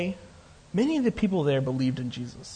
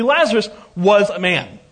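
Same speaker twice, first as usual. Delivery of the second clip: "was" punched louder; stress on WAS; 4 semitones higher, 6 dB louder than usual. Real, not acted. Preacher stressing that Lazarus is dead, "was" hit hard. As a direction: lean hard on the word "was". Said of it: "emphasis on past tense".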